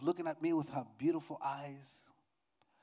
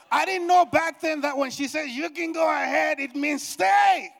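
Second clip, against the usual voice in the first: loud, high preacher's holler; exasperated shout; 10 semitones higher, 11 dB louder than usual. Acted. Preacher shouting what his wife really meant, exasperated holler.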